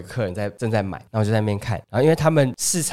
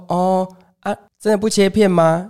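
higher, slower auntie voice, pressing again; persistent auntie; 7 semitones higher, slower than usual. Retold or imitated. Auntie asking the customer yet again about slicing, higher and slow. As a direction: ask about slicing once more, drawn out. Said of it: "然后就说".

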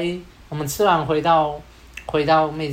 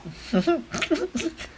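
high stifled laugh; 11 semitones higher, 5 dB quieter than usual. Real, not acted.

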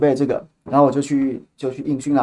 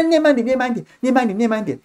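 pleading typist; 9 semitones higher than usual. Retold or imitated. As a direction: play an overwhelmed typist begging, voice pitched up. Retold overwhelmed typist begs him.

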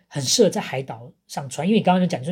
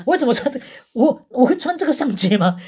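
incredulous; 9 semitones higher, 5 dB louder than usual. Retold or imitated. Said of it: "你就说".

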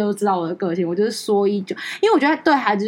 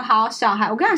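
ordinary talk, nothing marked

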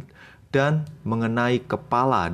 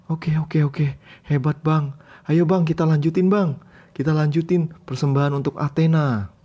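high eager follower; 8 semitones higher, 4 dB louder than usual. Acted. Follower humoring the boasting hero.

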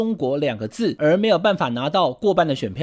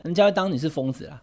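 normal talking voice

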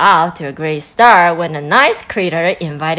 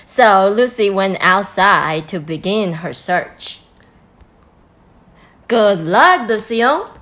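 ordinary delivery

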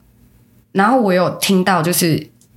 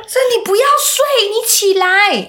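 put-on high, laughing child's plea; high giggly kid voice; childlike voice. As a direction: echo the kids, still giggling. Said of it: "那小朋友就说".

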